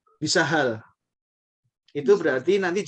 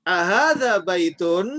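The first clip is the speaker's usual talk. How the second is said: slow, loud and higher, every syllable drawn out; teacher model reading; 3 semitones higher, 5 dB louder, slower than usual. Shown as a demonstration. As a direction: read it clearly for the class to repeat. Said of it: "saya baca, Bapak Ibu tirukan". (guru membaca pelan dan keras untuk ditirukan)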